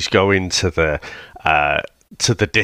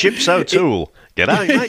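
high-pitched, hammed-up Aussie accent; mock Australian accent; 10 semitones higher than usual. Co-host riffing on film's accent, mock Australian. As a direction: put on a jokey Australian twang, pitched up. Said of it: "we'll talk about his Australian accent".

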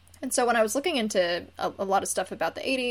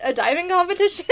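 surprised; 10 semitones higher, 6 dB louder, much faster than usual. Retold or imitated. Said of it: "I was like".

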